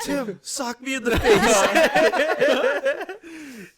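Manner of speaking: very high, loud, slow laughing plea; shrieking laughter. Pretend. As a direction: squeal it out laughing. Acted quote, very high loud slow.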